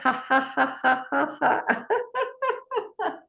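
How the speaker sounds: long high laugh; high rolling laughter; exercise laughter. Shown as a demonstration. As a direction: start in ha-has and run on laughing. Laughter exercise, tea bag step.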